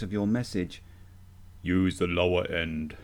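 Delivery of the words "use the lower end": low, deep demo voice. Shown as a demonstration. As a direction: drop into the bottom of your range. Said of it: "Use the lower end of your voice range".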